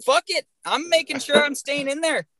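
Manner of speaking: high, cocky imitation of a stubborn rider; defiant imitation. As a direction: toss it off like nothing scares you. Voicing Jake's defiant mindset.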